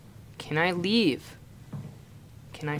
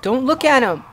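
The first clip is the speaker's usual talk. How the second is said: higher, louder frantic yell; panicked shout; 5 semitones higher, 9 dB louder than usual. Real, not acted. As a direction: shout at yourself in terror. Monster right behind him.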